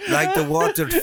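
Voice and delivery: high, light imitation of the German girl; mock Britta line. Co-host echoes the German girl's line in high mock voice. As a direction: echo her line in a mocking, high voice. Mocking her line, laughing.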